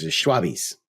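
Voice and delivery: Schwabbies, rhymes with wobbly-ish, stress on SHWAH; slang pronunciation. Shown as a demonstration. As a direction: give the riders' slang Schwabbies, low-key. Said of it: "I go by the slang term".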